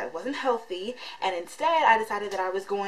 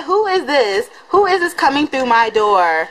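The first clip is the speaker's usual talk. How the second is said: mock surprise; 3 semitones higher, 13 dB louder than usual. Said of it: "I said".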